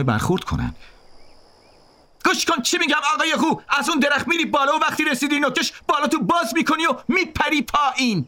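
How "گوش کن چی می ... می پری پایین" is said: high-pitched shouted command; bullying orders. A narrator's reading. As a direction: order him about in a high yell.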